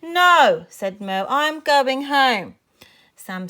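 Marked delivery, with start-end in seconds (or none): 0.0-0.7 loud, high, emphatic; firm refusal. A narrator's reading. Upset child to friend urging her over, loud refusal. 1.3-2.6 loud, slow, higher insistence; upset refusal. A narrator's reading. Upset child to friend, stubborn drawn-out refusal.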